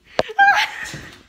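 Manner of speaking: a laugh that jumps very high and loud; high shrieking laugh. Pretend. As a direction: burst out in a squealing laugh. Salad box moves.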